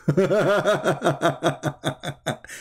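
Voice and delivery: high laugh at the clip. Real, not acted. Amused host laughing at a clip.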